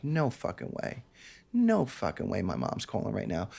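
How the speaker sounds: soft, low disbelief; stunned hush. Retold or imitated. Seeing "Mom" on the ringing phone, a stunned hush. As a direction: barely say it, shaken. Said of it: "I was just like".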